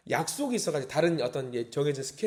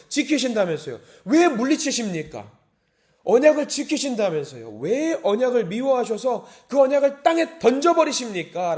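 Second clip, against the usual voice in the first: high, loud acted remonstrance; indignant protest; 7 semitones higher, 5 dB louder than usual. Psalmist protests to God over the broken covenant, indignant. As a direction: throw pointed questions up at God, voice raised. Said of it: "역정을 내고 있는 기도".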